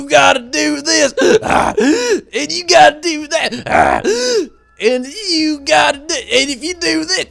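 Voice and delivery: high, loud ranting with gasps; hammed-up hellfire preacher shouting rules; preacher yelling. Imitating the preacher yelling at the crowd, mock shouting. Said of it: "just, like, telling you".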